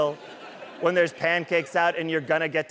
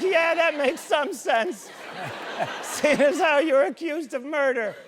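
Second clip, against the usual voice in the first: amused mock agreement, pitched way up; sarcastic laughing; 10 semitones higher than usual. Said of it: "And I was like".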